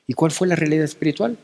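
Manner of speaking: normal talking voice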